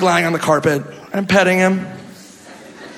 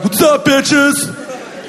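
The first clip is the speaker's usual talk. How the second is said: mock bar guy; 7 semitones higher, much slower than usual.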